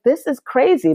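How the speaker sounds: high, breaking cry of disbelief; mild replay of a crying outburst; retold tearful outburst. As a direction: relive the moment you broke down, pitch jumping up. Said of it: "I started crying".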